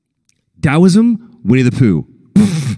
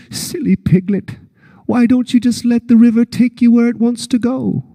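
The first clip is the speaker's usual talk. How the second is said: a higher, fond, teasing bear voice; Pooh impression; 11 semitones higher than usual. Pooh laughing at Piglet as he floats by, teasing. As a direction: chide him kindly, like a cheerful bear. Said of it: "horrible impressions".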